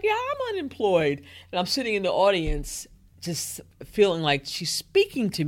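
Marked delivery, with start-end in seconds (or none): 0.0-1.2 high-pitched, emphatic self-quote; eager inner reaction. Retold or imitated. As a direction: blurt it out high, reliving the moment. Quoting own eager inner reaction.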